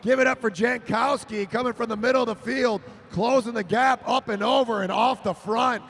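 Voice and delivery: announcer hailing the winner; excited wrap-up. Real, not acted. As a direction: celebrate the winning move.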